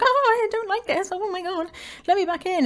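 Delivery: high, pleading little dog voice; dog's voice. Pretend. Scared dog begging its owner to come back in, high and pleading. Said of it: "he was like".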